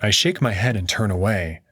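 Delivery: usual speaking voice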